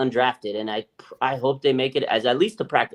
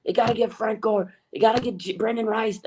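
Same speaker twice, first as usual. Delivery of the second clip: high, whiny mock fan demand; high fan imitation; 7 semitones higher than usual. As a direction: nag it like pushy fans. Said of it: "all these people were thinking about".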